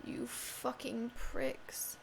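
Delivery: slow hissed whisper; mock-menacing whisper; whispered curse. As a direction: breathe the insult out slow and secret. Cursing game enemies.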